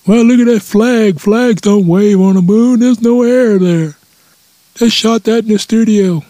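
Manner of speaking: high-pitched, drawn-out caricature of a denier; mock hoax believer. Comedian parodies a moon hoax believer, high mocking voice. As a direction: whine it out in a high cartoon voice.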